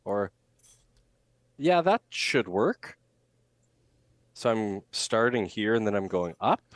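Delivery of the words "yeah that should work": higher, slow, ending on an unsure upward lift; upward inflection. Shown as a demonstration. Coach demonstrating an uncertain speaker's rising sentence ending. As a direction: say it as if doubting your own words. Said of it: "when I end my sentences going up like that".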